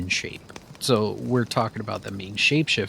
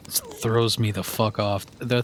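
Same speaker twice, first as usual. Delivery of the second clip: ordinary talk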